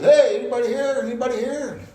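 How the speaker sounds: re-enacted loud, high call; yelling out. Young man calling into a dark factory, yelling. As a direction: holler to find whoever is around. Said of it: "I started yelling".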